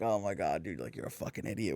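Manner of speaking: low, soft exasperated aside; muted dismay. Retold or imitated. Reacting to a friend's choices, quietly exasperated. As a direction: let it sink low, as if thinking it privately.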